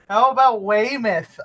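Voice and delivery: put-on teasing Boston accent; mock Boston accent. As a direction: riff on the Boston Accent skit. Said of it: "a skit called Boston Accent".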